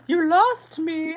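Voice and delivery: gasp, then a slow, high "You lost me"; startled high dismay at the comment; surprised gasp. Real, not acted. Reacting to a viewer who left, gasping in surprise.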